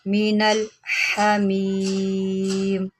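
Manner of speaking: slow chanted tajweed; Quran recitation. Shown as a demonstration. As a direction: draw out Hamim in a recitation tune. Recites the verse.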